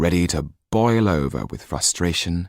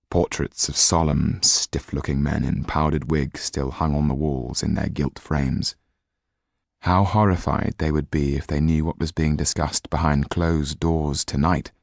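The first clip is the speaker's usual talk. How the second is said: plain reading voice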